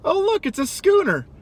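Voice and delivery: put-on high, chirpy kid voice; lightly voiced excited kid; mock little kid. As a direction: point it out like an eager child. Kid voiced in film retelling.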